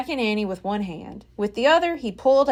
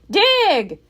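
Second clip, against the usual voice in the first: loud higher command; Captain Bones shouting at the diggers; shouted; 8 semitones higher, 5 dB louder than usual. A narrator's reading. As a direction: bark it at the crew. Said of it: "shouted Captain Bones".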